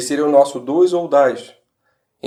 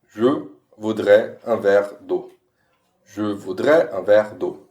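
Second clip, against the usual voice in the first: slow demo; 5 semitones lower, slower than usual. Shown as a demonstration. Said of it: "Sendo assim".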